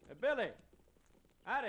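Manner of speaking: loud, high hail across the street; hey, loud and high, to catch his eye; calling out. Acted. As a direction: call out to him from afar. Hailing friend from a distance.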